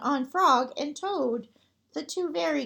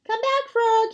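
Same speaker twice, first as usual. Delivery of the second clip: much higher, louder shout; 9 semitones higher, 5 dB louder than usual. Acted. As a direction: call him back desperately. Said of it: "he shouted".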